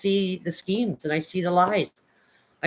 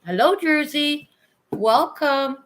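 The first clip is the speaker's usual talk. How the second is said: bright high greeting; 9 semitones higher, 4 dB louder, slower than usual. Real, not acted. Greeting a chat viewer.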